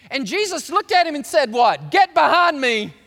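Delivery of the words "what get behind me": loud, sharp Get behind me; stern rebuke. Acted. Jesus rebuking Peter, loud and stern. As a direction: rebuke him with force.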